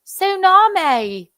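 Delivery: high, stretched Chapel Hill drawl; Tar Heel accent. Acted. Teasing the clip before playing it, exaggerated Chapel Hill accent. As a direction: draw the word out with Tar Heel twang. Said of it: "She looked up a Chapel Hill".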